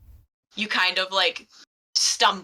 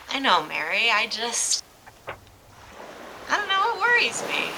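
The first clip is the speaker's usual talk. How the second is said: put-on worried young man; 4 semitones higher, slower than usual. Young Absalon fretting over the dive.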